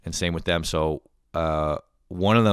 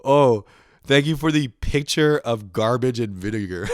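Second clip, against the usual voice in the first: higher, louder sarcastic thanks; hammed-up fake thank-you; mock gratitude; 6 semitones higher, 7 dB louder than usual. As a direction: gush thanks you don't mean. Acted sarcastic thanks for unwanted gift.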